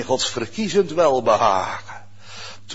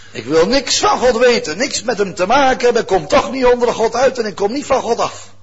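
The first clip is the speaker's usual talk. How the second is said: rebellious voice; 7 semitones higher, 6 dB louder than usual. Pretend. Voicing rebellious person.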